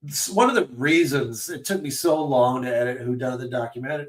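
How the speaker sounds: usual speaking voice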